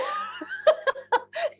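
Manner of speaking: high burst of laughter; laughing. Real, not acted. Storyteller cracking up at the memory, real laughter. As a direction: break up laughing mid-story.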